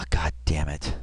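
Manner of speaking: sighed, whispery low curse; frustrated mutter. Real, not acted. Host fumbling the pause button, quiet exasperated curse. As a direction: curse softly under a sigh. Frustrated at his own pausing mistakes.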